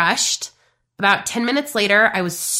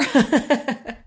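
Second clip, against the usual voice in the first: chuckling after the joke; short burst of giggles; amused laugh; 4 semitones higher than usual. Real, not acted. Coach laughing at her friends' teasing, amused.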